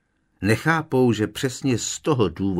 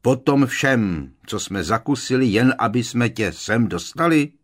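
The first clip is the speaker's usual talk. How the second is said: loud, indignant growl; exasperated outburst, growled at a friend; growling protest; 5 semitones higher, 7 dB louder than usual. A narrator's reading. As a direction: protest loudly, rising in pitch. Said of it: "zavrčel Hagrid".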